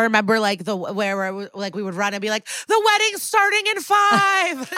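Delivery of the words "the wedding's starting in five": high sing-out playground announcement; much higher, put-on kiddie call. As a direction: imitate a child summoning everyone to play. Imitating children announcing a wedding.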